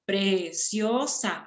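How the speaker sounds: very slow, split into syllables. Shown as a demonstration. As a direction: count it out syllable by syllable. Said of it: "vamos a contar".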